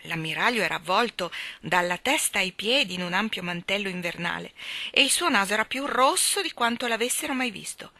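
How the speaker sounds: usual speaking voice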